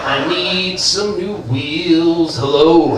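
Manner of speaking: slow, drawn-out crooner voice. Retold or imitated. Star greeting the car salesman in a slow crooner voice. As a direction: croon each hello like a lounge star. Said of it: "this is [name]".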